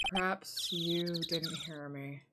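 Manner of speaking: low, very slow near-murmur; icy dismissal, barely bothering; aloof cold witness. Acted. Cold witness snubbing the lawyer, slow and hushed.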